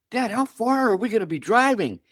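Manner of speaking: high, rising "And this is just you and me?"; mock alarm at a road trip with dad; incredulous son. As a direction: replay the stunned teenager, voice climbing. Young self asks about distance.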